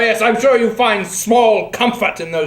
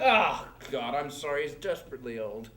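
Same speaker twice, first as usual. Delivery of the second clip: hushed aside; 10 semitones lower, 13 dB quieter than usual. Acted. Embarrassed aside about dad.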